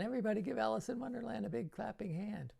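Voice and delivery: rally the crowd to clap; a kids' show host whipping up applause, high and fast; calling for applause. Shown as a demonstration.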